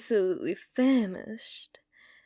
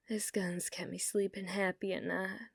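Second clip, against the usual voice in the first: whisper; 3 semitones lower, 10 dB quieter than usual. Acted. Whispered confession to bartender.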